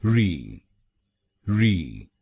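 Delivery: "री" said alone as a light REE; last syllable. Shown as a demonstration. Teacher drilling the last syllable "री", clear. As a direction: finish on a light falling "री". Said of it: "आखिरी शब्दांश को यानी आरवाई हम बोलेंगे".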